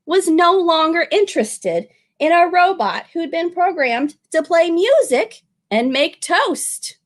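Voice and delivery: plain reading voice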